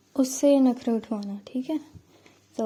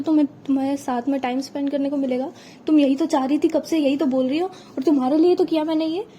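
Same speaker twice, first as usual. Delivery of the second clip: louder, quick self-defence; rushed defensive reply; 2 semitones higher, 3 dB louder than usual. Acted. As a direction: talk fast, justifying yourself. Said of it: "flow flow में जल्दी जल्दी बोल जाती है".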